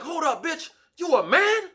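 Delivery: high, loud outraged demand; high loud outraged "you a man". Retold or imitated. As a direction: confront her in disbelief. Homie confronting in outrage.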